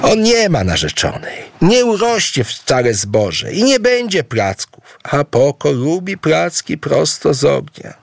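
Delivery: high-pitched, heated objection; scornful denial. A narrator's reading. Aboe rejects Idu's fiancée and harvest claims, scornful. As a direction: dismiss it all in a high, insistent voice.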